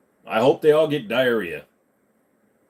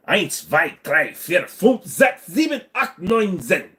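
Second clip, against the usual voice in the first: counting to ten in a mock German accent, climbing higher; 5 semitones higher, slower than usual. Streamer counts in German for chat, mock German accent. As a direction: rattle off the German numbers, hamming it up. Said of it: "try speaking German".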